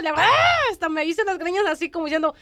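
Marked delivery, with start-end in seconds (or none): 0.2-0.7 very high frustrated aah; a cry of despair at the dilemma; desperate cry. Retold or imitated. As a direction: let out a cry of exasperation. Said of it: "me sentí una desesperación por dentro".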